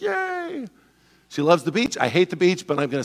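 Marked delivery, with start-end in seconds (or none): 0.0-0.7 very high, soft squeal of joy; squealing wife. Retold or imitated. Wife hearing about the beach trip, very high squeal. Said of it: "She's like".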